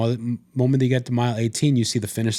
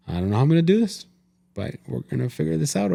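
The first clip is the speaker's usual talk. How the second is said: higher, fast, tearful break; voice crying; 4 semitones higher than usual. Retold or imitated. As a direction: let tears crack through. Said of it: "or my voice cried".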